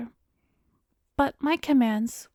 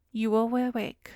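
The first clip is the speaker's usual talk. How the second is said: normal talking voice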